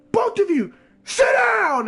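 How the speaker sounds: loud, high commanding shout; sudden yell. Retold or imitated. Calm teacher finally shouting at two fighting boys, loud. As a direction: bark the order to stop the fight. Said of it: "he literally just yelled".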